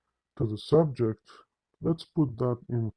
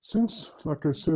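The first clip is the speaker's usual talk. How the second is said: ordinary delivery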